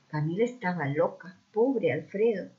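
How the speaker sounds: plain reading voice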